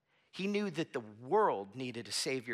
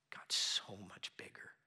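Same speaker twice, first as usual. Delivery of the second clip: whisper; 8 semitones lower, 7 dB quieter than usual. Real, not acted. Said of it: "unvoiced whisper".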